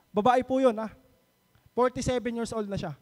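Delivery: ordinary talk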